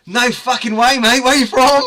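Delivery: loud, high Aussie exclamation; Australian accent. Retold or imitated. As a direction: belt it out like an excited Aussie. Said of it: "Aussie guy at the bar".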